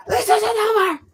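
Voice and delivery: high, loud wrestling promo shout; wrestler promo yell. Pretend. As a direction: bellow it like a heel promo.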